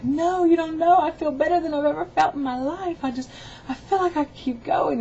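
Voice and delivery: high, loud, insistent retold excitement; elated protest. Mother insisting to her son she feels great, elated.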